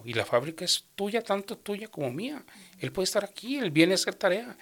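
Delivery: defensa acalorada, un poco más aguda; reclamo indignado. Retold or imitated. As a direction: sube la voz sin gritar, defendiendo al hijo. Discusión con el hermano. (le reclama al hermano, defendiendo a su hijo, algo más agudo)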